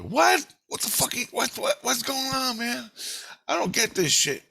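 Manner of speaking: high-pitched, baffled retelling; bewildered outburst. Retold or imitated. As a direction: stammer it out in high-pitched disbelief.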